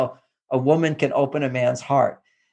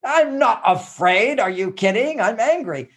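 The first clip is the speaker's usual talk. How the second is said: mock angry denial; 6 semitones higher than usual. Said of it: "if somebody's angry and I said".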